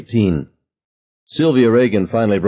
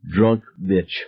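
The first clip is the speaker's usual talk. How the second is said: ordinary delivery, nothing marked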